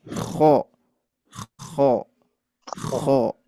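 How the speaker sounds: 「kho」を喉奥で太く重く; heavy kho. Shown as a demonstration. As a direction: say Kho with a thick, heavy throat sound. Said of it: "Pengucapan kho-nya seperti ini".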